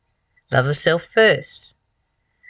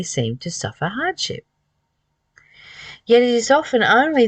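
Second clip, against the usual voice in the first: higher, impassioned delivery of the passage; fervent preaching; 4 semitones higher than usual. Acted. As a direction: lift the voice and preach it. Rereads passage with more fervour.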